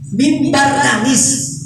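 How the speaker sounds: high, slow, stressed "Mimbar nangis"; insisting. Shown as a demonstration. Storyteller convincing children: high slow "Mimbar nangis". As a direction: drive home that the pulpit cried. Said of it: "gak percaya gak?".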